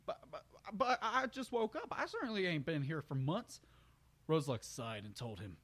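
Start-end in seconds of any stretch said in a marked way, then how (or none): 0.1-3.6 high, flustered half-laughing protest; disbelieving country stallion. Acted. Brayburn protesting he just woke up, flustered. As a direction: deny it nervously.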